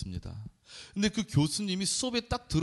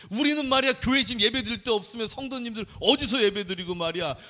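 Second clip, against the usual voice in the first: higher, louder indignant rant; mock inner rant; 4 semitones higher, 4 dB louder than usual. Pastor venting at the professor in his head, indignant and loud. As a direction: vent it like a grumbling pastor. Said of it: "속으로 막 괜히 화가 나는 거예요".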